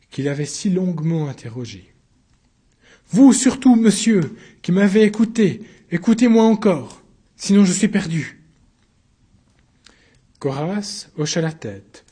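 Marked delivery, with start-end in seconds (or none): 3.1-8.4 high, loud imploring; urgent plea. Acted. Accused begging counsellor Coras, loud high plea. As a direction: beg for one last hearing.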